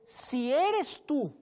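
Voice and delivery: very slow, high, loud drawn-out doubt; doubting question. Retold or imitated. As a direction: stretch "Si eres tú" like a suspicious challenge. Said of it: "comienza con una duda diciéndole".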